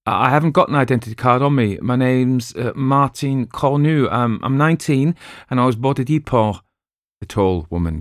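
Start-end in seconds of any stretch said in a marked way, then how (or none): 0.0-6.6 nervous stammer, quick and higher. Acted. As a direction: stumble over the words nervously. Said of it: "who stammered".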